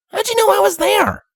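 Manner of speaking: high, brash filly voice; sporty cocky filly; tomboy pony voice. Acted. Rainbow voiced.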